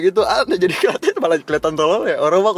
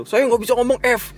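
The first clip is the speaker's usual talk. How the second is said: higher voice quoting the father; friend's father voice; 9 semitones higher than usual. Retold or imitated. Quoting a friend's father, higher mock voice. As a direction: lightly play the old man who can't say F. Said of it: "bapaknya nggak bisa ngomong F".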